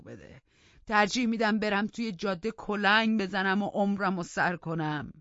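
put-on old Joseph loudly complains to the master; grumbling old servant. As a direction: whine and rant like an aggrieved old servant. Narrator voices old Joseph complaining.

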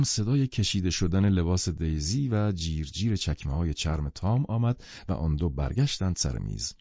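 ordinary delivery, nothing marked

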